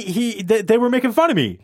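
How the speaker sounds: usual speaking voice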